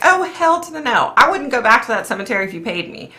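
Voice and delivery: high, sassy refusal; indignant. Retold or imitated. Telling her husband no way, high and sassy. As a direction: toss it off with sassy disbelief.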